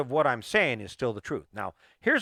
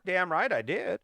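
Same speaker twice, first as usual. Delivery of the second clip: usual speaking voice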